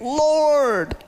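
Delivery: Lord! cried out high and long; screaming it. Shown as a demonstration. Preacher screaming to God, Lord! cried out high. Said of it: "he's, like, screaming it".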